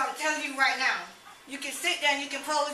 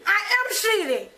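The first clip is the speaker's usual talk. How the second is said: high, loud, slow snap; exasperated snap; 6 semitones higher, 5 dB louder, much slower than usual. Real, not acted. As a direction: bite out each word in frustration. Annoyed reply to officer.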